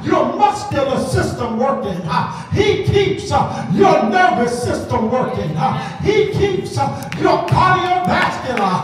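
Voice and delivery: high, shouted preaching chant; preaching at full force. Acted. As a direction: hammer each line like a preacher.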